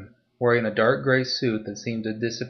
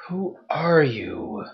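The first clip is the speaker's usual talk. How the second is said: slow, drawn-out and higher, in an odd strained voice; slow wary question; 4 semitones higher, slower than usual. Acted. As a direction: stretch it out, squinting after him.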